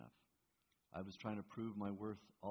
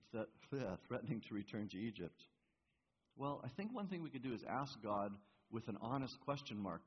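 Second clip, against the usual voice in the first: ordinary delivery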